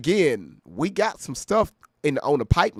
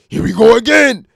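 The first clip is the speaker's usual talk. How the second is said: loud gruff rapper bark, higher and louder; 6 semitones higher, 12 dB louder than usual. Retold or imitated. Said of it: "in my D M X voice".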